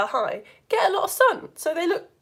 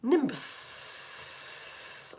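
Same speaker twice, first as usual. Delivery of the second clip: hissed whisper; 10 dB quieter than usual. Shown as a demonstration. Teacher acting out a nimbus cloud, long hissing whisper.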